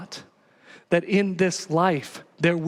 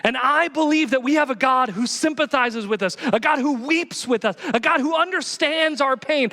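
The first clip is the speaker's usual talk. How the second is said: preaching at full force; 8 semitones higher than usual. Real, not acted. Pastor pressing the mission on the church at full force. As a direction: preach it hard to the congregation.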